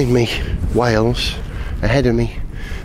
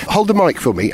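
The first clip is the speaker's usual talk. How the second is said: ordinary delivery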